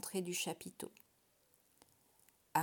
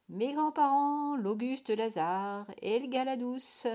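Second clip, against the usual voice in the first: comic barker, much higher and louder; fairground barker; 5 semitones higher, 6 dB louder than usual. A narrator's reading. Vadim presenting his grandparents, comic barker voice. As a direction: announce like a funfair showman. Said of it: "l'inflexion comique d'un bonimenteur de foire".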